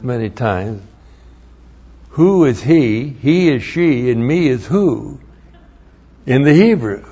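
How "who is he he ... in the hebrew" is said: normal talking voice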